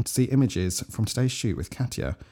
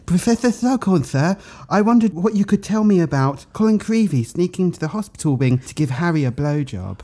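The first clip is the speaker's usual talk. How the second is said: higher, prim English pupil voice; English schoolgirl accent; 5 semitones higher than usual. Acted. Reading the card to Slughorn in an English schoolgirl accent. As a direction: play the polite English student. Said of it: "do it in that, that voice".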